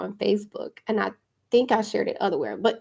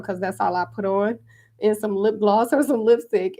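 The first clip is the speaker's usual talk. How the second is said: usual speaking voice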